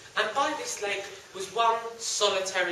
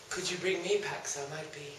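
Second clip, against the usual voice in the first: much softer and lower, sweetly asking; soft pleading; 6 semitones lower, 15 dB quieter than usual. Acted. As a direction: ask the lady ever so nicely. Young man asks lady.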